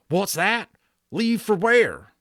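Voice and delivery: high, loud and startled cowboy; alarmed Boone. A narrator's reading. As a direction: jump up in alarm, drawn out.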